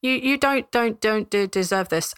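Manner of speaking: hesitant guard tripping over words; stammering guard. Acted. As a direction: stutter through it earnestly.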